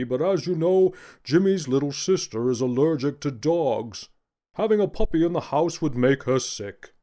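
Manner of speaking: jolly, booming Santa reading a letter; Santa Claus voice. Acted. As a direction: read it like a hearty old Santa.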